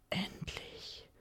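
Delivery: soft whispered longing. A narrator's reading. Longing for release from her lover, whispered. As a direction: breathe the word in a whisper.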